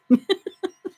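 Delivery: short high-pitched laugh. Real, not acted. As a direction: burst into a laugh. Laughs mid-sentence.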